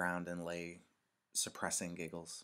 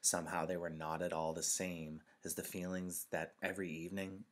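usual speaking voice

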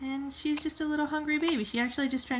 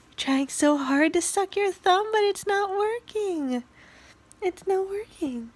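slow, higher sing-song teasing coo; baby talk; 8 semitones higher, 4 dB louder, slower than usual. Acted. Mom teasing her baby who can't suck her thumb, baby talk. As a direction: tease the baby sweetly.

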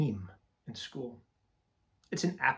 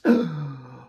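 gasp; 10 dB louder than usual. Acted. Sudden gasp after list.

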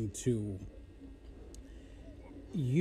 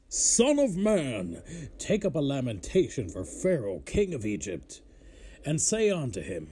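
scripture reading; 3 semitones higher than usual. Acted. Reads scripture aloud.

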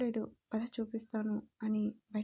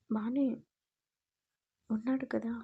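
arrogantly. Acted. Daughter-in-law answering her mother-in-law with mild arrogance. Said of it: "అంది పొగరుగా".

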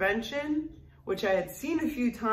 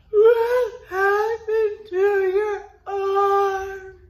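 high, slow tearful wail; crying sister; 11 semitones higher, 8 dB louder, much slower than usual. Retold or imitated. Sister in tears asks about the arm, high slow wail. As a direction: wail it through tears, much higher.

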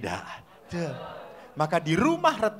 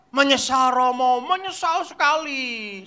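imitated children's chorus; 5 semitones higher than usual. Children answer priest emphatically.

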